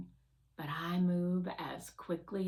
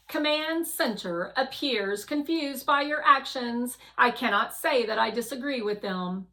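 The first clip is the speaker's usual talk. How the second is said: higher, louder, measured AI voice; Guardian's voice; 5 semitones higher, 8 dB louder than usual. Acted. Guardian the AI reporting to the rover, louder and higher. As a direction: speak as the watchful guardian machine. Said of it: "Guardian says".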